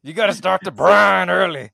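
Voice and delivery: loud, high hammed-up holler; mock swamp cook. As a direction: holler kitchen advice like a swamp hunter. Co-host as swamp hunter.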